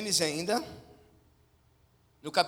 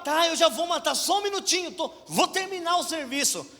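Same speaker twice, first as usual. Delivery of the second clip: flustered Abraham; 10 semitones higher, 7 dB louder than usual. Acted. Abraham flustered answering God.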